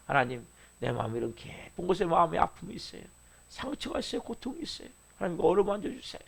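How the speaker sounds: soft, intimate plea to God; hushed prayer. Acted. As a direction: pray it quietly, confiding your hurt. Models a prayer of confessing hurts. (하나님께 조용히 아뢰는 기도)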